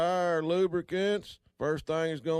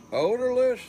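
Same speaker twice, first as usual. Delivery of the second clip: much higher, louder astonished repeat; surprised echo; 10 semitones higher, 6 dB louder than usual. Shown as a demonstration. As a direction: bounce it back in disbelief.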